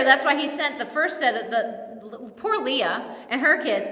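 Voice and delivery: ordinary talk, nothing marked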